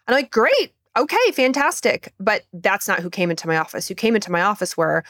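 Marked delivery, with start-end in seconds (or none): 0.3-2.1 high, breezy cheer; mock upbeat approval. Doctor answering patients who feel great, mock upbeat. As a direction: cheer them on in a much higher pitch.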